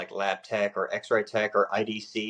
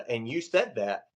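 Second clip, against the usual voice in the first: ordinary delivery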